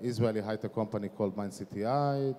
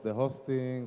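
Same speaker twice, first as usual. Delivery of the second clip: usual speaking voice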